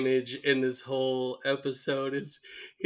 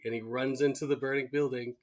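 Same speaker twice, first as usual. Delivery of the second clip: usual speaking voice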